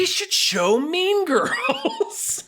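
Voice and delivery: high, slow, laughing through it. Real, not acted. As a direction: giggle the words out. Laughing suggestion.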